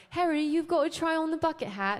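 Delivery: a light put-on British accent; half-hearted British accent in a dream; lightly British, chirpy; mock British accent. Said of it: "And I was British for some reason".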